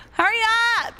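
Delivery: high, urgent cry; panicked urging. Real, not acted. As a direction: shriek at a teammate to move. Urging teammate during chase.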